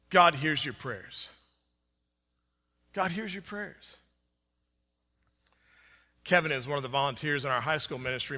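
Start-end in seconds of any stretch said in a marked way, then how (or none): 2.9-3.9 much softer, reverent restatement; hushed repeat. Real, not acted. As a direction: drop the volume to let it sink in. Preacher repeats key point softly.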